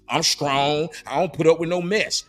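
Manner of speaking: defiant, sassy declaration; no-nonsense tough woman voice; tough attitude. Retold or imitated.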